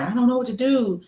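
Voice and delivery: mother quoting her crying son, mild mock sob; imitating a crying child. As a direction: lightly act out a son crying after a bad dream. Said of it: "Like, he was crying".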